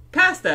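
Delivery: "Pasta" high and loud, short a as in "cat"; Canadian pronunciation. Retold or imitated. Canadian retelling a misunderstanding to Americans, "Pasta" insistently with short a. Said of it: "And I'm like".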